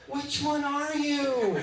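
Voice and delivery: very high, slow child impression; mock kid voice. Fifth grader asks the little officer, high slow kid voice. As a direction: imitate a fifth grader, stretching the question out. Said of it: "one of the kids immediately goes".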